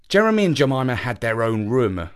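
plain reading voice

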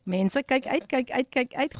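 ordinary talk

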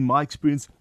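ordinary talk, nothing marked

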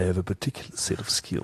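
low, soft, menacing film threat; hint of a calm movie threat; Liam Neeson. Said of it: "Ek wil baie graag Liam Neeson".

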